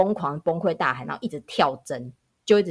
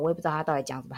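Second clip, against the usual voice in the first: normal talking voice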